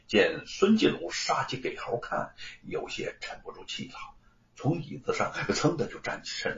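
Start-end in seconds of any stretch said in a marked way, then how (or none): none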